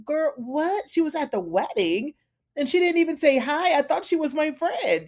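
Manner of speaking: hammed-up hurt surprise; mock outraged disbelief. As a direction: play the betrayed friend. Serena hears June was there.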